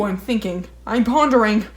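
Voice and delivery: usual speaking voice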